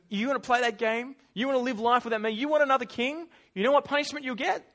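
acted stern dare; confronting challenge. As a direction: throw the questions at them, building. Acting God challenging Israel.

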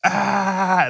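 high victory cry; triumphant yell. Retold or imitated. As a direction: roar like you've won. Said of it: "And you go".

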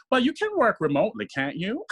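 a breezy boss asking a favor quickly; imitating the CEO. CEO voiced in story.

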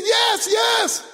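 high, urgent cry of agreement; man crying out to be saved; desperate yell. Acted. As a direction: agree frantically at a high pitch. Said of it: "and he screamed".

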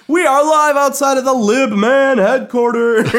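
live on-scene reporter, loud and high; news reporter voice. Pretend. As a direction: announce it like a TV field reporter. Mock live broadcast from parking lot.

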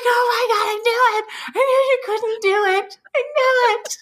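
very high squeal, near crying with laughter; retold helpless laughter. Wife to husband after the failed lift, retold helpless laughter. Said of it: "We are laughing so hard".

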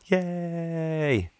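high, drawn-out little cheer; long high-pitched hooray; celebratory cheer. Acted. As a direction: cheer in a high voice without raising volume. Cheering reaching double figures.

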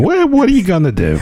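high, mock shrug voice; mock shrugging New Yorker. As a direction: toss it off like a shrugging wiseguy. Mocking the Bert quote, shrugging.

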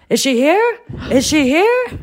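Jerry calling out for the guest, lightly imitated; eager calling out. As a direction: call out eagerly from another room. Jerry calling from the dressing room.

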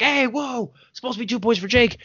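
high-pitched coach calling out to the ref; coach protesting. Retold or imitated. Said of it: "My coach was like".

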